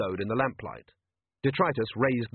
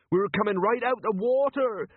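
high-pitched tearful wail, acted; weeping; 10 semitones higher than usual. A narrator's reading. Captain despairing as ship leaps, high weeping wail. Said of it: "wept Jenkins".

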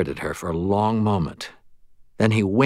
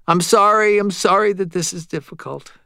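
much higher-pitched, apologetic medium; sighing apology; 10 semitones higher than usual. A narrator's reading. Medium apologizes to grieving Murphy, high apologetic voice. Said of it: "He blew out a long breath and said".

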